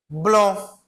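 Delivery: "Blanc" nasal vowel, silent C; teacher models "Blanc" with no C; French demo. Shown as a demonstration. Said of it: "não vou pronunciar o C".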